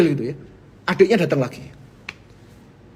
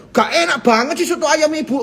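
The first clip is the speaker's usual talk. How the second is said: mock rude diner; 7 semitones higher than usual. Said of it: "saya bilang".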